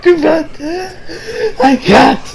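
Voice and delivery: high, breaking up with laughter; helpless laughing. Real, not acted. Host failing to retell the scene, real helpless laughing. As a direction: barely get it out between laughs. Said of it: "without me laughing my ass off".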